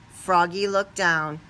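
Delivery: usual speaking voice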